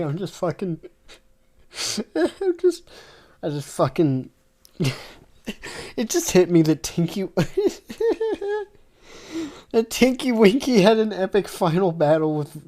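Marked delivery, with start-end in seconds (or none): 2.1-12.7 giggling, breaking off; laughing through the words; cracking up. Real, not acted. Reader cracking up at the story. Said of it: "I'm sorry".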